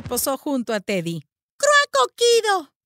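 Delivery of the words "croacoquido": high, loud, slow caw; crow-speak; croaking. Acted. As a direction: drag it out like a crow. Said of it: "graznó ella".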